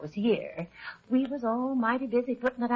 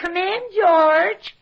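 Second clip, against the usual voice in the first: loud, slow call with a higher pitch; 10 semitones higher, 9 dB louder, slower than usual. Acted. Wife calling her husband George in from outside, loud and drawn out. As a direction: call him in from the doorway.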